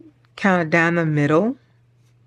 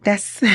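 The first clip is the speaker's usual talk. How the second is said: real emotional laugh, catching in the throat; choked-up laugh; 4 semitones higher than usual. Real, not acted. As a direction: laugh through rising tears. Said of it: "I'm getting choked up for real".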